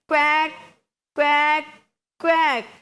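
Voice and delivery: three loud separate quacks; duck quack. Shown as a demonstration. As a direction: imitate a duck for the children. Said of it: "ഉറക്കെ പറഞ്ഞേ".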